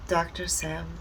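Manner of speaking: usual speaking voice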